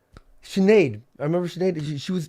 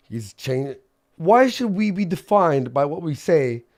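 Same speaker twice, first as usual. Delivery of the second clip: ordinary delivery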